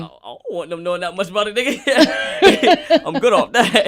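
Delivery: high, loud laughing refusal. Real, not acted. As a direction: laugh it off, waving the idea away. Laughing refusal about DNA test.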